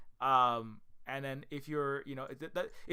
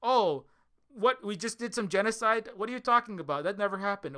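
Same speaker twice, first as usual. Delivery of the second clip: mock innocent denial; 6 semitones higher, 5 dB louder than usual. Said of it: "when you're trying to cite".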